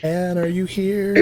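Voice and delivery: singsong roll call; calling out for someone. Real, not acted. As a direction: stretch each word, searching for a missing person. Calling out for absent Anne.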